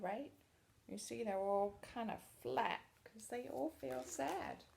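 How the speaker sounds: usual speaking voice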